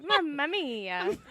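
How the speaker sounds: slow, high mock Italian; mock Italian accent. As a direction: stretch it out in a hammy Italian accent. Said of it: "what accent was that".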